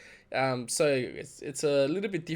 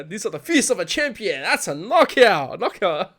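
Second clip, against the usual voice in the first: raised, loud mock announcer call; higher-pitched ringside announcer impression; fight commentator; 3 semitones higher, 7 dB louder than usual. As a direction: imitate a booming match commentator. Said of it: "Your next job is to be a commentator".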